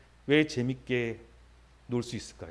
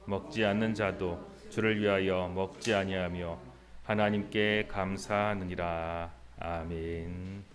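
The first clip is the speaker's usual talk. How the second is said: slow, measured Bible reading; scripture reading; 8 semitones lower, slower than usual. Acted. Pastor reading Romans to the congregation, slow and measured. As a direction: read each verse deliberately, like from the pulpit.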